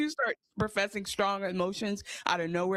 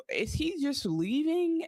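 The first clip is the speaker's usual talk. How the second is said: mock disbelief; 9 semitones higher, slower than usual. Own reaction while watching.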